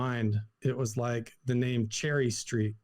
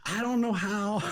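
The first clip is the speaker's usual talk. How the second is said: said through real laughter, higher; amazed laugh; 8 semitones higher than usual. Real, not acted. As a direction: laugh in disbelief at the coincidence.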